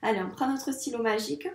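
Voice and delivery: ordinary delivery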